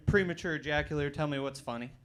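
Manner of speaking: normal talking voice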